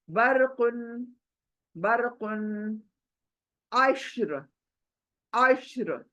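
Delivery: अश्र ending on a thick र; repeat, र heavy. Shown as a demonstration. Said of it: "र मोटा". (अश्र में र मोटी)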